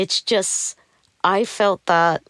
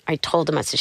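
usual speaking voice

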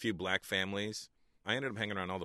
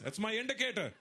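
put-on much higher Indian dad accent, laughing; 14 semitones higher than usual. Comedian voicing his dad, much higher accent, laughing. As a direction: state it proudly like a father. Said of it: "I go".

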